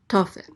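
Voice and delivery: "Tofel" with TO loud, fel dropping away; stress on first syllable. Shown as a demonstration. Teacher repeating the name after explaining it, stress on TO of "Tofel". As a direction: hit TO firmly in "Tofel". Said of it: "the accent is on the first syllable".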